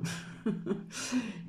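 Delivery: short high chuckle. Real, not acted. Vlogger joking to viewers about the poor weather, chuckling. Laughs after remark on the weather.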